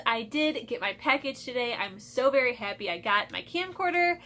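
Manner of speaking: usual speaking voice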